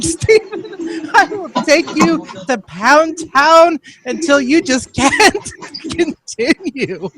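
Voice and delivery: camp voice. Acted. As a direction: camp it up high while cracking up.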